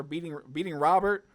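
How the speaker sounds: excited win. Real, not acted. Gamer celebrating his win on stream, excited. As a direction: let the win burst out. Winning the game.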